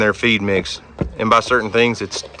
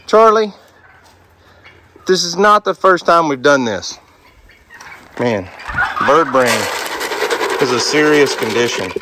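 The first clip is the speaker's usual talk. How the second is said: coaxing an animal; 5 semitones higher than usual. Real, not acted. Coaxing Charlie back over the fence.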